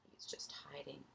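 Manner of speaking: hushed whisper. Real, not acted. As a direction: whisper it to the class.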